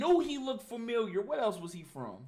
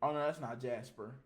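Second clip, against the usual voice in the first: normal talking voice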